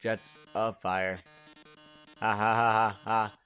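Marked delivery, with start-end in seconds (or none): 2.2-3.3 mock villain laugh. Mettaton mocking the player.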